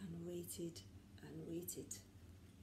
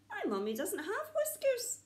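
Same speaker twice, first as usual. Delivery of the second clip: high, small owl voice; a little chick talking to himself; 11 semitones higher than usual. Acted. Little owl talking to himself about the creature, high.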